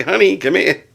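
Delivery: calling out. Retold or imitated. Calling his wife from upstairs.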